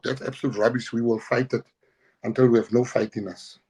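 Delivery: ordinary talk, nothing marked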